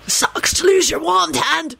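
high, slow raspy taunt; strained, drawn-out rasp. A narrator's reading. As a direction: rasp it out slowly, pitched high. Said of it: "Sirius rasped out".

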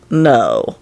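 low, final no; flat refusal. A narrator's reading. Lupin refusing the servant's hopeful request, firmly.

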